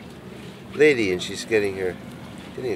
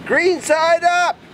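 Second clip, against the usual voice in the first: high, slow drawn-out call; yelling out window; 6 dB louder, much slower than usual. Retold or imitated. Contractor yelling to sod layers outside, long high shout. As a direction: call it long across the yard.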